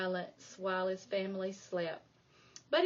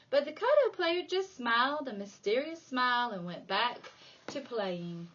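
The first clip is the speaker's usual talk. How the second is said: plain reading voice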